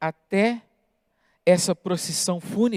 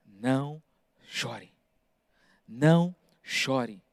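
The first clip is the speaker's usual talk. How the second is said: softer and slower, gently consoling; soft, tender comfort; 7 semitones lower, 7 dB quieter, slower than usual. Acted. Jesus consoling the weeping widow, soft and slow. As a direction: soothe a grieving mother, quietly and slowly.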